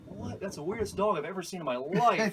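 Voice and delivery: lightly hammed-up disbelief; stunned onlooker. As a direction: play a baffled passerby. Stunned motorist staring at alligator.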